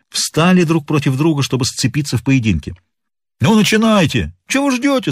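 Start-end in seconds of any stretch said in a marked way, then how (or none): 3.4-5.1 high, impatient urging; pitched-up heckle without real volume; calls from the crowd. A narrator's reading. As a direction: egg the fighters on from the floor. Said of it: "слышалось из зала".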